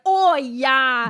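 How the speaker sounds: loud Canadian "Oh, yeah"; eager, punched Canadian vowels; excited Canadian accent. Retold or imitated. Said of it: "we were so excited".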